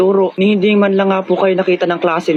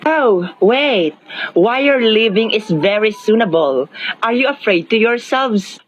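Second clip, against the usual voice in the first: hammed broken English; 2 semitones higher, much slower than usual. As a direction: stretch out the broken Filipino-English accent. Broken-English comic speech.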